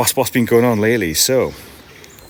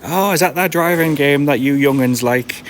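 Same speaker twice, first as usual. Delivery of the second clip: put-on a playful old-granddad voice, pitched higher; mock granddad; 7 semitones higher, 3 dB louder than usual. Teasing a viewer about his driving game as a granddad. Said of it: "I sound like a proper granddad".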